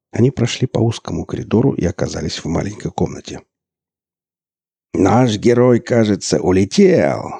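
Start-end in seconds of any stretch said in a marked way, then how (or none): 4.9-7.3 loud hammy mic patter; mock announcer. A narrator's reading. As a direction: ham it up on the mic. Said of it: "разглагольствовал в микрофон Борланд".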